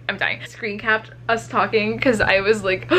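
normal talking voice

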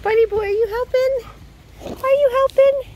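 high cooing voice for a pet. Real, not acted. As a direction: coo up high at the dog.

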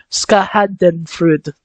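Schadenfreude sounded out very slowly; careful pronunciation. Real, not acted. Player reading a hard German word, slow and unsure. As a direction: spell out Schadenfreude syllable by syllable, unsure. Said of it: "not sure if I said that right".

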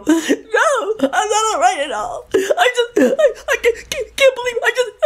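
very high, choked wailing; acted sobbing. Passenger answering cab driver who asked if she's all right, sobbing. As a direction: sob it out, words stuttering and breaking off. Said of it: "I'm crying".

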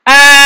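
long, high, very loud yell; loud scream. Real, not acted. Player failing in the game, loud long scream. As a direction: scream it out at length.

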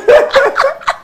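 high-pitched burst of laughter. Real, not acted. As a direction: laugh at your own innocence. Amused by her own naivety.

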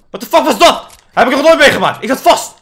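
high, loud outraged exclaiming; loud disbelief. Real, not acted. Frustrated gamer shouting after getting stuck.

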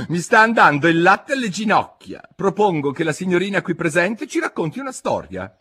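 high, loud, fed-up character voice; high-pitched, exaggerated complaint; bored March Hare. A narrator's reading. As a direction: propose it as a tiresome March Hare.

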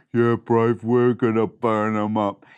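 low, loud mouse tough guy; deep-voiced brother. Mouse brother agreeing to chase chickens, deep-voiced. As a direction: drop it down low, agreeing with swagger.

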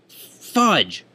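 loud, high exclamation of frustration; frustrated outburst. Real, not acted. Tutorial maker after losing a recording, exasperated. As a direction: burst out with a mild swear, high and loud. Venting over lost recording.

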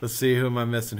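normal talking voice

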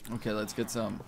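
ordinary delivery

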